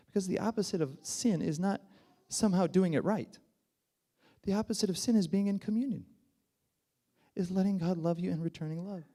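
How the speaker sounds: high, loud, urgent preaching; impassioned preaching. Real, not acted. Preacher rising to full force.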